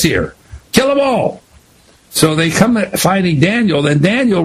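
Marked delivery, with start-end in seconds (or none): 0.7-1.4 king's order. Acted.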